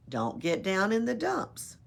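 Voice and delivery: ordinary delivery, nothing marked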